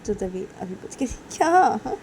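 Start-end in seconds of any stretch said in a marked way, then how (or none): 1.4-2.0 high, loud defensive whine; acted indignant comeback; mock protest. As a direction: protest loudly in a lifted voice. Brother protests when told off.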